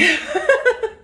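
short high laugh; embarrassed laugh. Real, not acted. Embarrassed laugh after sniffling.

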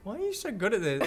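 ordinary delivery

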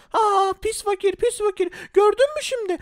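high, slow mocking sneer; taunting bully. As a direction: drag out the insult like a spoiled brat.

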